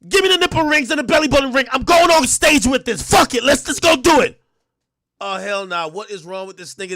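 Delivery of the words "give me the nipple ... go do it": high, loud, pumped-up demands. Retold or imitated. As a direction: bark it like a diva before a show. [name] voiced demanding outfit.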